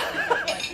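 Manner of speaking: short high giggle; quick embarrassed real giggle; high laugh. Real, not acted. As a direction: giggle off a small fumble. Flustered moment at the podium.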